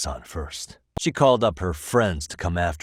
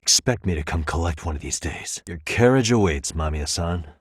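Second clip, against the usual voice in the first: ordinary talk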